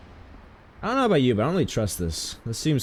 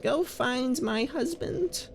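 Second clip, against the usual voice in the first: high soft lady voice; 6 dB quieter, much slower than usual. Retold or imitated. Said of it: "she was like".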